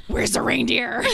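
a lighter take on the frantic mom voice; crazy voice. Retold or imitated. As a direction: insist again, slightly unhinged. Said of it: "And then my mom goes".

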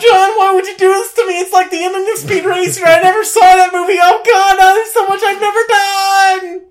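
high, loud overacted death scene; melodramatic dying. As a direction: ham up a tragic death cry. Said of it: "I'm, I'm dying".

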